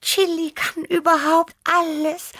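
admiring, very high little cat voice; Tibi. A narrator's reading. Cat Tibi admiring heroic Chili, high and awed. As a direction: marvel in a small high voice. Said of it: "sagte Tibi bewundernd".

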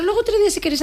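high teasing lilt; playful high aside. Real, not acted. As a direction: pop the voice way up, teasing. (tono agudo y juguetón)

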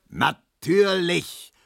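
long, drawn-out furious roar; sarcastic, stretched-out yell; bellowing. A narrator's reading. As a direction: bellow it slowly, high-pitched. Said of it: "brüllte Herr Markowski".

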